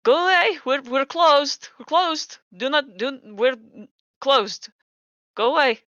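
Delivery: high, loud shout through a closed door; frantic, stammered shouts of refusal. Acted. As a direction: yell in alarm to send callers away. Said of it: "you hear someone shout".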